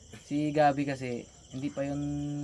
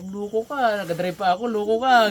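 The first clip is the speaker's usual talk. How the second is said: mock scolding; 6 semitones higher, 9 dB louder than usual. Retold friend scolding jokingly.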